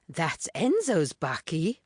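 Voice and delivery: lightly voiced friend, slow; a gently slowed young girl's voice; Puleng speaking. A narrator's reading. Puleng's voice in the story.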